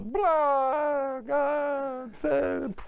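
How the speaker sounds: very high, loud bawling; exaggerated drawn-out wail; mock wailing sob. Imitates her wailing at the kotel.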